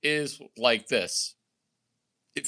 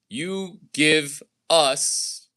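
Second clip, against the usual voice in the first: you give us drawn out slowly; slow, deliberate you give us; very slow emphasis; much slower than usual. Shown as a demonstration. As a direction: spell out give us word by word. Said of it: "Let me repeat that Michael Jordan deal".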